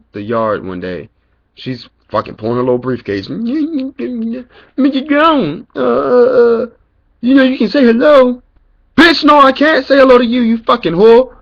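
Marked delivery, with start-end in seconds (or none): 3.3-8.4 high, squeaky mock professor voice, much higher and louder; mocking a snooty professor, pitched way up. 9.0-11.4 loud, high furious shout; screaming back at the professor in a rage; angry retort. Retold or imitated.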